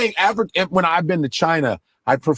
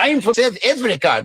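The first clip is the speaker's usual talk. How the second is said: mock South African accent, pitched up; 4 semitones higher than usual.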